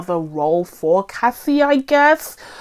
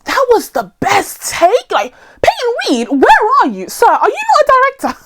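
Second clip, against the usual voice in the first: high, loud disbelief, words punched out; incredulous outrage; 13 semitones higher, 4 dB louder than usual. Acted. Reviewer calling out the director over a bad take, incredulous outrage. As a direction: demand answers from the absent director.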